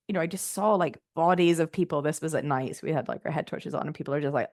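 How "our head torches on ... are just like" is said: ordinary delivery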